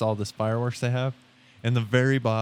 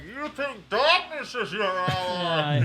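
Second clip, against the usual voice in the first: Bane voice; 7 semitones higher, slower than usual. Retold or imitated. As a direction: growl it like a masked villain. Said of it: "Fucking Bane".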